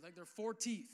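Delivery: ordinary talk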